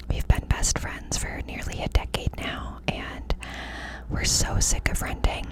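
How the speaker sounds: ordinary talk, nothing marked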